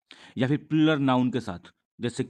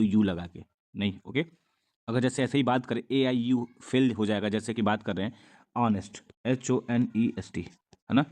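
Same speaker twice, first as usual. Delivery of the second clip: usual speaking voice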